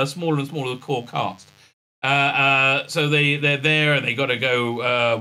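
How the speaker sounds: usual speaking voice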